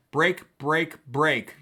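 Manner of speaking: Break, break, break as three separate firm beats; triple stress. Shown as a demonstration. Teacher quoting a Tennyson poem, each break evenly stressed. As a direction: put full weight on every break. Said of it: "three stressed syllables in a row".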